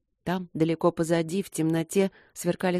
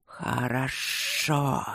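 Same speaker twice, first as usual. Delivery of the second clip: slow, drawn-out rumbling assent; rumbling spirits; 3 semitones lower, much slower than usual. A narrator's reading. Spirits suddenly agree to help Luke, slow rumble. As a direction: roll the word out ponderously. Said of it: "вдруг пророкотали духи".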